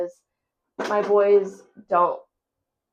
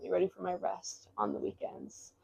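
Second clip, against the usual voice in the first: much softer, keeping it down; quiet; 12 dB quieter than usual. Real, not acted. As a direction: murmur it over the workout video. Quiet talk during workout video.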